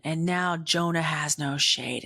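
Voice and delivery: ordinary talk, nothing marked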